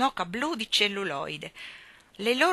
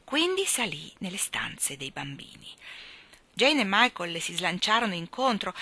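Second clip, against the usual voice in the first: ordinary delivery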